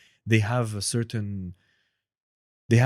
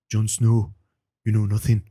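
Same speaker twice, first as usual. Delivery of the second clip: louder, grave Game of Thrones line; Game of Thrones impression; 7 dB louder than usual. Shown as a demonstration. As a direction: deliver it like a solemn Westeros character. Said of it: "I try to mimic the sound".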